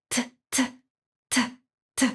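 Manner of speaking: soft clipped "t" repeated, barely voiced; quiet teacher demo of "t"; t sound demo. Shown as a demonstration. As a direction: tap out "t, t" quietly for the class. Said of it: "você vai fazer o som de t".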